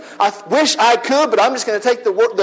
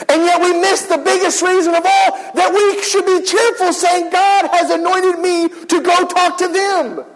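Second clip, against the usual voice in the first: higher, near-shouting urgency; fervent preaching; 7 semitones higher than usual. Real, not acted. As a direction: press the point hard. Preacher urging cheerfulness.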